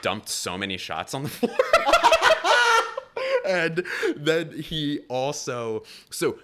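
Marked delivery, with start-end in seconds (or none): 1.6-3.4 high loud burst of laughter; big laugh. Real, not acted. Comic laughing at his own shot-dumping story, loud burst. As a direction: crack up hard.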